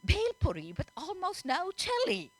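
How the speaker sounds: high, careful repeat for someone not understanding; very slowly. A narrator's reading. Boy repeats his order to the confused server, slowly. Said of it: "the boy repeated very sh-, slowly".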